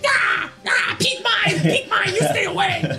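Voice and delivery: very high feral creature shout; kobold warding off. Acted. Kobold with knives guarding his pig, shrieking warning. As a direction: shriek possessively as a tiny kobold. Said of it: "he's just like".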